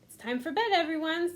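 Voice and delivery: lightly voiced grandma calling bedtime. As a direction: call them to bed like a grandma. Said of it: "said Max's grandma".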